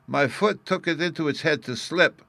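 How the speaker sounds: put-on high, loud small boy; little boy voice. A narrator's reading. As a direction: argue like an indignant little boy. Bruno tells story.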